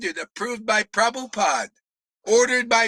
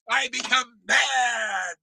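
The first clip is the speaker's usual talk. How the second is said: slow, heavy, shouted; half-laughing slow angry shout; getting mad; slower than usual. Real, not acted. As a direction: drag it out as the temper rises.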